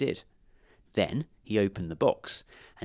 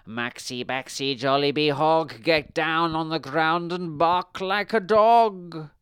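incantation building to a loud, high command; witch casting spell; 7 semitones higher, 6 dB louder, slower than usual. A narrator's reading. Witch cursing Jane, a chant building louder. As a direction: chant the curse, rising and stretching it out. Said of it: "she cast a spell".

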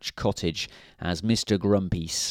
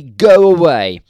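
a loud, higher bark to go away; loud snap; 7 semitones higher, 14 dB louder than usual. A narrator's reading. Mister Grumpy sending Mister Happy off, loud bark. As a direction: shove him off with your voice. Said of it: "snapped Mister Grumpy".

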